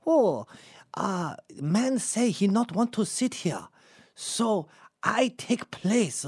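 put-on high-pitched, drawn-out caricature of a Japanese accent; mock Japanese accent. Comic retelling of a seatmate speaking to stewardess, exaggerated Japanese accent. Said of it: "that's Japanese".

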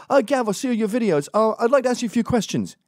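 high, quick pestering-fan voice; mimicked fans. Fans coming up to ask Gav questions, high mocking imitation. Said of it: "people were coming up to me like".

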